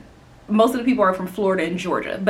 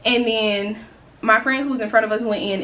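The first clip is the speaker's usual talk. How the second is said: normal talking voice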